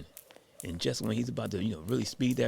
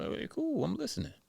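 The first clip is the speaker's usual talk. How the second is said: much higher-pitched, going along; placating. Retold or imitated. Sabata agrees to just listen, high-pitched placating. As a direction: jump up high to concede.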